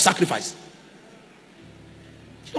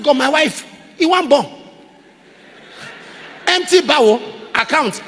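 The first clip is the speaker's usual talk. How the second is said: higher, louder protesting plea; pleading protest; 7 semitones higher, 4 dB louder than usual. Retold or imitated. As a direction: argue with God in disbelief. Protesting to God, retold.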